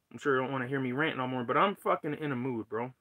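ordinary talk

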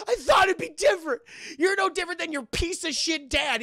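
hammed-up furious outburst; mock angry shout. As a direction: yell it like a betrayed friend. W'Kabi angrily berating T'Challa.

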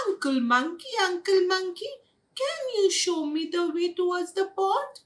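high, lilting sweet plea; polite request. A narrator's reading. Goldie asks Uncle Monkey the way, polite request. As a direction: ask nicely in a little singsong voice.

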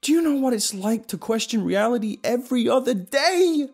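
loud, high frantic rant; ranting yell. Acted. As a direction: rant at full volume, unhinged. Paranoid rant at friend.

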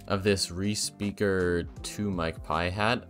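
ordinary delivery

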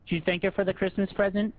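ordinary talk

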